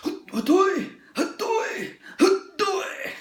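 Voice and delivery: very high squeaky cartoon cry, repeated; mock the internet guy with a falsetto yelp; shrill falsetto meme call; imitating a Facebook guy. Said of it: "that guy on Facebook that's like".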